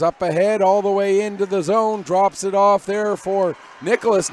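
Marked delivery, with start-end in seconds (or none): none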